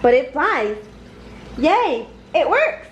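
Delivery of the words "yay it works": higher, mild cheering shout; a light happy cheer, pitched up. A narrator's reading. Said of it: "everyone shouts".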